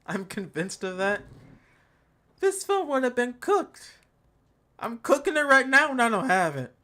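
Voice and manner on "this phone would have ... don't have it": raised, heated rant with a higher pitch; mock anger. Real, not acted. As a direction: fume at the phone like it's personal. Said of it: "That's how much anger I have right now".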